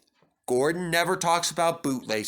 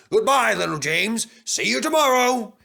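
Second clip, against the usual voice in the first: taunting; 9 semitones higher, 6 dB louder than usual. Acted. Gordon taunting James on departure.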